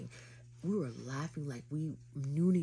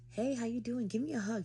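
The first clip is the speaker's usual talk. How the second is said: high-pitched flirty greeting; putting on sauce; 8 semitones higher than usual. Retold or imitated. Greeting her date on live, high and saucy. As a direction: lift the voice high and lay on the charm. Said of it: "I was putting that sauce".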